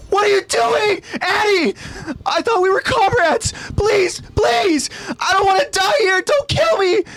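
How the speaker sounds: high shrieking plea; terrified scream. Acted. Marco screaming in terror as Annie strips his gear. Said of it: "leaving him to scream out in fear".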